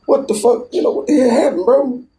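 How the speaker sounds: high, pressing questions; mock-shocked, voice held high; incredulous. Asks friend after jail.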